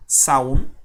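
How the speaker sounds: "saún" run together as one syllable; joined as one syllable. Shown as a demonstration. Teacher joining two vowels, "saún" as one syllable. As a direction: link sa and un into a single "saún". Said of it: "como una sílaba".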